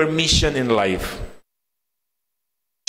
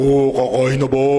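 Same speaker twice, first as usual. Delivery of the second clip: louder, lower teasing imitation of a big voice; mocking deep voice; 5 semitones lower, 3 dB louder than usual. Preacher recalling brothers teasing his deep voice, mock low. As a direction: siblings aping his newly deep voice. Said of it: "ginagaya yung paglaki ng boses".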